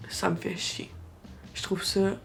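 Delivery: ordinary talk, nothing marked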